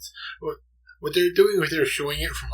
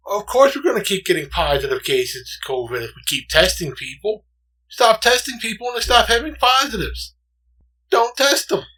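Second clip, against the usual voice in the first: lightly mocked politician, building louder and higher; Donald Trump; 8 semitones higher, 7 dB louder than usual. Quoting the president on covid testing, mock-insistent and rising. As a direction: imitate a president insisting, climbing in pitch. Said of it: "Donald Trump once said".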